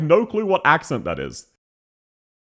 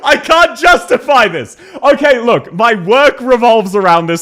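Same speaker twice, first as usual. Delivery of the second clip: loud protest; 9 semitones higher, 10 dB louder than usual. Real, not acted. Flustered laughing protest at himself.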